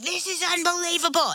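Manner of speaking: high, amazed kid imitation; pumped-up kids. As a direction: imitate children out of their minds with excitement. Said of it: "they are pumped out of their minds".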